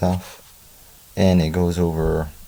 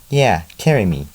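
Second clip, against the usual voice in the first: plain reading voice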